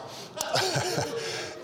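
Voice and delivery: airy high-pitched laugh. Real, not acted. Pastor laughing at his own wedding story, breathy and high.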